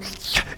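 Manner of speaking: put-on quick sharp gasp of a caught kid; mock startled gasp. Child caught by mom at cookie jar, startled gasp.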